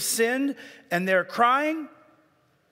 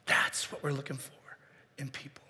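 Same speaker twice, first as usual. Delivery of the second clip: whisper; 7 semitones lower, 8 dB quieter than usual. Real, not acted. Preacher drops to whisper.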